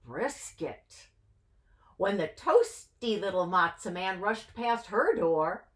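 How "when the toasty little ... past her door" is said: ordinary delivery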